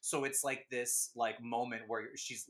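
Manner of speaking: normal talking voice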